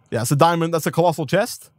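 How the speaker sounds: normal talking voice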